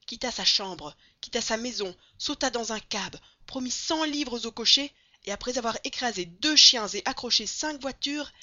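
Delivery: loud, driving narration gaining momentum; excited story narration. A narrator's reading. Narrator on Phileas Fogg dragged off to the club, urgent. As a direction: narrate the headlong rush with mounting energy.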